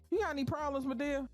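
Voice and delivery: high challenging voice; Ellen. Acted. Ellen challenges Madea in class, high and sharp. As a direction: call her out sharply. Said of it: "Ellen questioning Madea".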